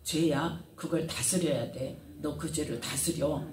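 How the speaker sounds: lightly voiced God, low and soft; 하나님 말씀 대언. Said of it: "해답을 말씀하십니다". (가인을 타이르듯 낮고 조용하게)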